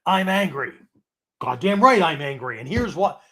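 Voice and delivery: fired-up, self-righteous outburst; worked-up anger. Shown as a demonstration. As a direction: let the anger flare and feed itself. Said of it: "you gotta be careful that you go, don't go".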